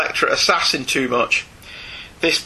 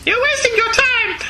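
high female voice. Acted. Voicing Meryl in the comic.

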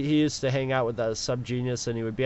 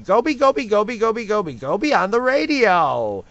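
loud, high cartoon promo voice; Stimpy; 7 semitones higher, 6 dB louder than usual. Voice actor's promo for a college DJ, cartoon impression. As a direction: belt it out as a goofy radio jingle character. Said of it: "he did it in Stimpy's voice".

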